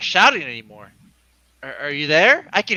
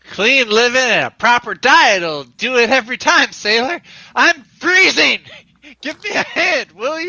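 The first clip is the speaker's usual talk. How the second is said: shouting; 6 semitones higher, 7 dB louder than usual. Acted. Calling up from the water.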